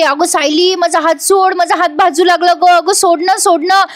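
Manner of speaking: high, whiny staged complaint; theatrical mock protest; fake wailing. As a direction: overplay the victim, pleading to be let go. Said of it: "मोठ्याने कांगावा करते".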